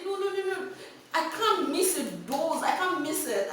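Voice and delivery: high, insistent no-no-no; urgent pleading. Retold or imitated. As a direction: plead urgently not to miss the pills. Pleading with teacher to leave.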